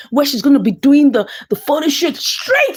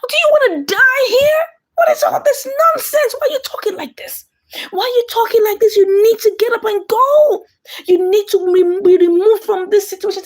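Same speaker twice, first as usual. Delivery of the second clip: put-on higher, sharp impatient girl voice; girl scolding; 7 semitones higher than usual. The girl scolds the battered wife, much higher and sharp. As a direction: snap at her in a much higher voice. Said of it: "Then the girl said".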